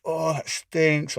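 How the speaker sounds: imitating his wife recoiling from the egg smell; disgusted wife. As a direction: drag it out like she can't stand the smell. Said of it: "she's like".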